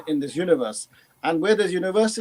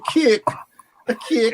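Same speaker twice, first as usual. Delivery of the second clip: loud, higher imitation of a Geordie "cake"; mock Geordie accent; 5 semitones higher, 7 dB louder, slower than usual. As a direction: parrot the accent back, louder. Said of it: "is that Geordie accent".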